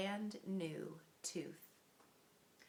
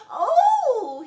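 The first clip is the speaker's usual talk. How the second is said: sharp high gasp cry; a loud startled gasp; sudden shocked "Oh!"; 21 dB louder than usual. A narrator's reading. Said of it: "he gasped".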